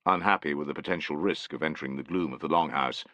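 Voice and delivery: ordinary delivery, nothing marked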